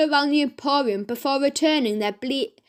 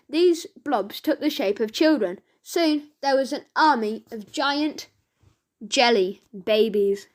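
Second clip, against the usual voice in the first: ordinary delivery, nothing marked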